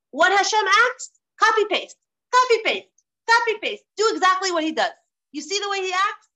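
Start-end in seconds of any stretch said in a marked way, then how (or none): 0.1-4.9 emphatic preaching. Real, not acted. Emphatic sermon point.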